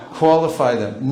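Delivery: ordinary delivery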